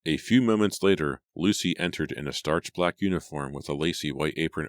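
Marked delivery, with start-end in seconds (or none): none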